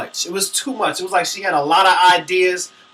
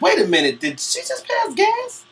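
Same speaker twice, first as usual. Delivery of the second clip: much higher and slow, shocked; scandalized gossip at the awards show; shocked disbelief; 11 semitones higher than usual. Retold or imitated. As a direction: squeal "pass gas?" in disbelief. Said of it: "I was like".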